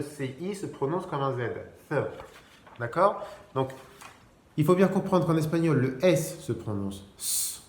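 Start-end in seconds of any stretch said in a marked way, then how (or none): 1.9-2.0 "th" with tongue between the teeth. Shown as a demonstration. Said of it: "ma langue, elle sort là". 6.0-6.4 final "S" as a voiceless "sss"; S as hiss. Shown as a demonstration. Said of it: "comme en français".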